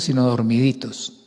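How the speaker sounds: ordinary talk, nothing marked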